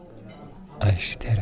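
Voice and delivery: hushed whispered love word. Real, not acted. Telling her partner I love you in a whisper. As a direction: breathe it like a secret. Soft Japanese lesson to lover.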